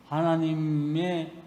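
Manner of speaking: ordinary delivery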